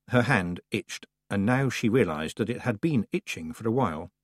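usual speaking voice

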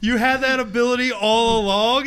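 acted incredulous protest; mock incredulity. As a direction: demand an answer in mock disbelief. Imagined reaction to Dracula's history.

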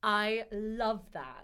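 loud, drawn-out delight; real enthusiasm, slow and emphatic; quite excited. Real, not acted. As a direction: gush slowly, stretching every word. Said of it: "So you sounded quite excited".

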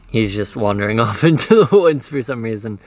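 ordinary talk

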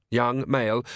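ordinary delivery, nothing marked

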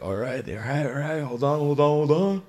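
rising, high mock urgency; urgent. Acting friends readying the bong.

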